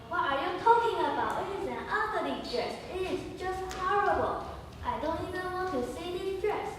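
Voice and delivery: high, loud scornful outburst; Laura mocking her friend's dress choice; scornful calling. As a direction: sneer at the ugly dress. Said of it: "Laura is calling".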